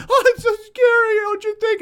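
very high, loud mock-terrified wail; hammed-up freak-out. Histrionic person trying to scare a companion, hammed-up freak-out. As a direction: play up fake, tearful fright. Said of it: "the person all freaks out".

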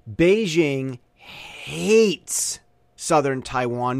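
Beijing hates Southern Taiwan, drawn out word by word; slow emphatic jab. Acted. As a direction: lean hard on every word, very slowly. Joke about Beijing hating Taiwan.

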